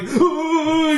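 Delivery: high, drawn-out mock "ooh" of terror; imitated frightened wail. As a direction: imitate a trembling climber's wail. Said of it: "he's shaking".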